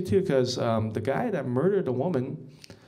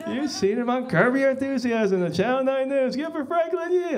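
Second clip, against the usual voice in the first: laughing, pitched-up announcer hype; mock emcee; 9 semitones higher, 4 dB louder than usual. Emcee hyping Frank's credits, laughing. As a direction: build him up with a laugh.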